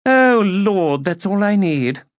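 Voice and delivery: grand lady put out; exasperated haughty lady. A narrator's reading. Lady hearing of an unwelcome guest, haughtily exasperated.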